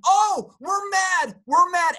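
high, loud and slow hammed-up fury; mock angry shout. Speaker showing relapse into stress, mock angry shout. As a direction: drag out the mad like a cartoon grump. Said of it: "think about that again".

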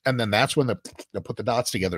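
ordinary talk, nothing marked

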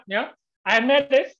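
bigger triumph. Retold or imitated. Author relishes being first double winner in years, slow and elated. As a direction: stretch the victory out gleefully. Retelling winning two awards.

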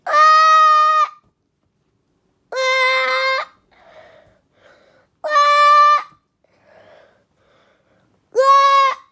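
high falling scream. Acted. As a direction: scream in terror. Screaming through portal.

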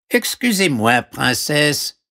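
high-pitched frog character voice; voix de grenouille. Acted. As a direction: politely call out in a high frog voice. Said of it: "dit une voix". (voix aiguë de grenouille)

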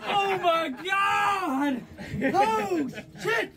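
loud laughing cries; laughing shout. Real, not acted. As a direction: laugh and yell at once. Laughing shock in cold.

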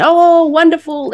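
high, loud, slow delighted cheer; drawn-out crowd delight. Retold or imitated. Audience cheering after colleague explains.

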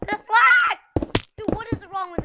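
frustrated scream. Real, not acted. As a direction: scream it at the game. Gamer screaming at lag.

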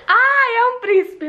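high, slow, lovestruck sigh; gushing, lovestruck; swooning. Real, not acted. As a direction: melt over him, drawn out. Swooning over boyfriend.

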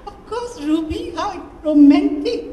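high, gushing coo, giggly; cooing with excitement. Acted. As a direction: swoon over the romance. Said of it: "cooed with excitement".

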